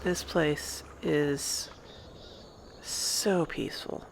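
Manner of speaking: slow, soft, savoring the quiet; hushed, unhurried calm; peaceful awe. Real, not acted. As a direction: let each word settle, slowly and softly. Taking in a peaceful riverside.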